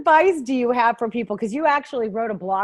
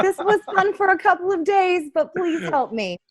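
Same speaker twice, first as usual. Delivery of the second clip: mock plea; 8 semitones higher than usual. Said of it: "they're saying".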